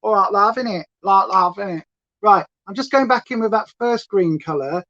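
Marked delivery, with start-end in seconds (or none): 0.0-1.8 mock Essex accent. Teasing her chat in a mock Essex accent. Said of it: "No one says lovey in Essex".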